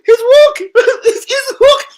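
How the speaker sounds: high, loud mock squeal with a laugh; mocking Neville's commentary. Commentator shrieking at a walking player, mock squeal. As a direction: ham up a pundit's outrage. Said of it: "I remember Neville".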